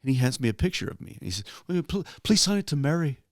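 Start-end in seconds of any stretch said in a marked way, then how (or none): 1.7-3.2 higher-pitched, amateur Liverpudlian voice; Liverpudlian accent. Retold or imitated. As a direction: politely ask in a rough Liverpudlian imitation. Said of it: "do a very good Liverpudlian accent".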